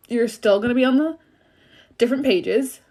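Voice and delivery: talking through hiccups; interrupted by hiccups. Real, not acted. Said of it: "I'm hiccuping, sorry".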